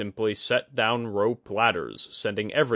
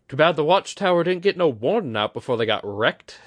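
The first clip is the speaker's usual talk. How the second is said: higher, louder and dryly ironic; wry; 7 semitones higher, 6 dB louder than usual. Acted. Kudaka joking darkly about the fallen watchtower, wry. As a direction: toss off the bad news with a wry edge. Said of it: "she said wryly".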